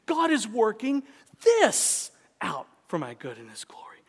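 God is working high, then this out for my good and his glory whispered; high God is working, whispered rest; stress on God is working. Shown as a demonstration. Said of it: "emphasize different words in that sentence".

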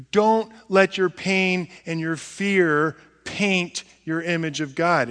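ordinary talk

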